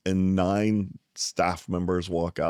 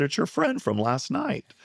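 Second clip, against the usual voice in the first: lightly voiced woman on the phone, pitched much higher; breezy friendly caller; 10 semitones higher than usual. The woman from last night calls, high and cheery. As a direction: sing it out, chirpy and familiar. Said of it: "And she said".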